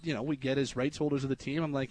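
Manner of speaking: ordinary talk, nothing marked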